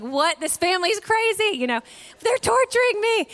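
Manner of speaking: high squeaky little bird in a panic; panicked bird voice. Acted. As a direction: give the hummingbird a high frantic voice. Voicing the panicked hummingbird.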